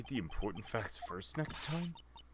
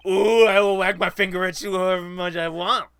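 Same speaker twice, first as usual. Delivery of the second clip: loud taunting; 10 semitones higher, 16 dB louder than usual. Acted. Prosecutor voice retort in trial game.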